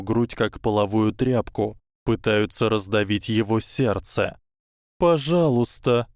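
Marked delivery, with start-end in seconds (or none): none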